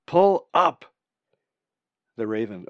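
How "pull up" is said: repeated warning shouted high and loud; urgent shout. Acted. The voice shouts again to the raven, urgent yell. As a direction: call out sharply from afar. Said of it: "came a voice".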